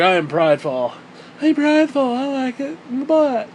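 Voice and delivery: high-pitched mock boast; mocking put-on voice.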